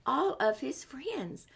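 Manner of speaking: slow hushed whisper. Shown as a demonstration. Reading to children, slowly whispering about the friends. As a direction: breathe it out slowly. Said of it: "whispered while reading".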